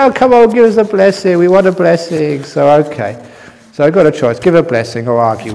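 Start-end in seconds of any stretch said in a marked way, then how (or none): 0.0-2.4 pleading. Retold or imitated. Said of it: "they say".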